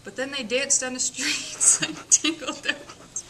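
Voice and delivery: ordinary delivery, nothing marked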